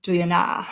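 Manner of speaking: "Juliana" wrongly said as ju-li-a-NAH; stress on last syllable. Shown as a demonstration. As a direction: rush to the end of "Juliana" and stress it. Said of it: "Do we say".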